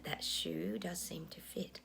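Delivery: "That shoe does seem to fit" breathed as a slow, voiceless whisper; slow conspiratorial whisper. Real, not acted. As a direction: hush it down like a knowing aside. Repeats the musing as a whisper.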